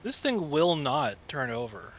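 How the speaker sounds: normal talking voice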